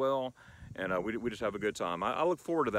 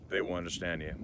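ordinary delivery

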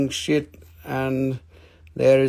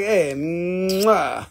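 TV chef kiss; 3 semitones higher than usual. Retold or imitated. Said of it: "like from the T V show".

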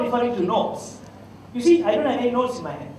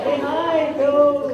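friendly greeting; 6 semitones higher, 5 dB louder, much slower than usual. Retold or imitated. Friendly foreigner greeting the girl.

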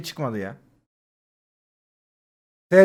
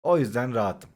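softer, lower, forced-serene self-soothing; mock calm; 6 semitones lower, 8 dB quieter than usual. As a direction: pretend meditation, holding the anger down. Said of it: "Evet sakinim". (sahte bir sakinlikle, alçak ve yumuşak)

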